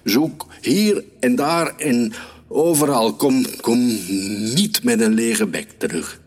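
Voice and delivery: high, insistent commands, settling slightly at the end; shrieked orders. A narrator's reading. Hunter orders the dog to search everywhere, shrieking. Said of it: "gilde ik tegen mijn hond".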